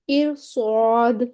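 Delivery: ارصاد drawn out very slowly with a heavy ر; heavy ra recitation. Shown as a demonstration. Teacher reciting the word to students, slow with a heavy ر. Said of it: "را کو موٹا پڑھیں گے".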